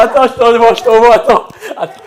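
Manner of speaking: high and loud, breathless with joy; excited shouting. Real, not acted. Student tells his mother to wait for the file, excited shout. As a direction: blurt it out, overjoyed.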